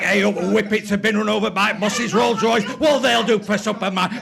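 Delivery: loud, ranting broad Yorkshire; Northern accent. Acted. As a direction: shout it like a broad Lancashire comic. Mock Northern rant.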